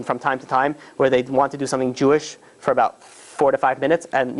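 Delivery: ordinary talk, nothing marked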